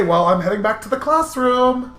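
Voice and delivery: ordinary talk, nothing marked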